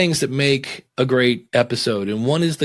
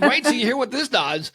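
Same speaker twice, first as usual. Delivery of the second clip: much higher hyped-up sportscaster voice; obnoxious sports guy; 10 semitones higher than usual. Shown as a demonstration. Mocking a pushy podcaster with a hyped sports voice. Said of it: "you could be obnoxious sports guy".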